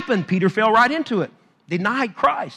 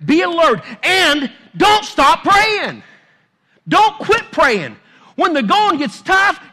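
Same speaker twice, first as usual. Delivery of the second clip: full-force preaching; 6 semitones higher, 7 dB louder than usual. Acted. Preaching builds to climax.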